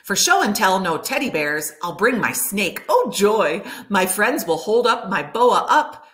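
ordinary delivery, nothing marked

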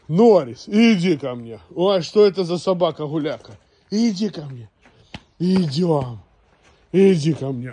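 loud, high calling; calling a dog. Real, not acted. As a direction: call the dog over loudly.

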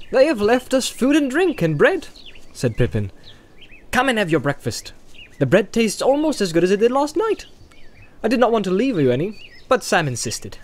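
put-on high, lively young hobbit voice; high-pitched, breezy storybook hobbit; cheery Pippin. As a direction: chatter brightly over breakfast, pitched high.

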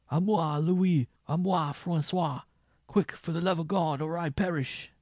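high, loud, panicked calling; desperate cry for help. A narrator's reading. Attacked minstrel begs his companions for rescue, desperately. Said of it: "the attacked man called again".

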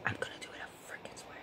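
whispered to herself; whispering. Real, not acted. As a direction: whisper it under your breath. Nervous pep talk to self.

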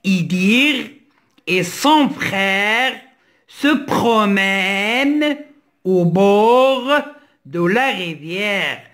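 slow, drawn-out reading in separate chunks; model sentence reading. Shown as a demonstration. Teacher reads the sentence for learners to follow, slow model reading. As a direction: read it out slowly for beginners, pausing between phrases. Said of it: "Je lis la phrase".

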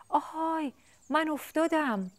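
very high, slow call after a fall; chick calling out. Acted. Fallen chick calling after fleeing elephant, high and drawn out. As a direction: cry out as the elephant runs off. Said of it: "داد زد".

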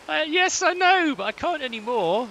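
high, loud laughing wail; laughing despair. Real, not acted. Player laughing after dying in the game, high laughing wail.

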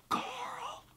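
hushed, very high call; Rick. Retold or imitated. Imitating Rick calling for his son, high and hushed. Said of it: "That's how he says it in the show".

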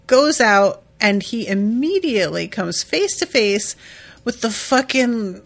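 ordinary talk

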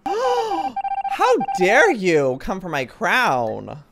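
gasp, then a loud, high, drawn-out protest; hammed-up indignant cry; mock outrage. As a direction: play grandly affronted, stretching the words out slowly. Mock quarrel over her crown.

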